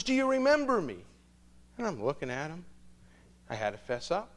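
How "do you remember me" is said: eager greeting. Retold or imitated. Excited old coworker greets him.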